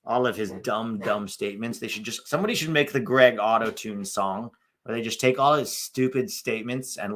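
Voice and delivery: ordinary talk, nothing marked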